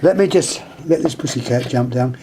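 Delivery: ordinary talk, nothing marked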